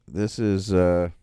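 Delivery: ordinary talk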